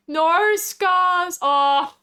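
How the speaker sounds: high, loud wail of "no"; drawn-out protest. Real, not acted. Streamer scolding Scars mid-chat, drawn-out protest.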